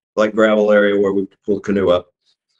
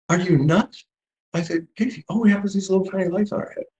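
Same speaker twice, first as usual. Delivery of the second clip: high, fast, alarmed protest; hushed but high-pitched panicky refusal; incredulous protest; 11 semitones higher, 3 dB quieter, faster than usual. Retold or imitated. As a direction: squeak out your disbelief at the idea. Refusing son's dare.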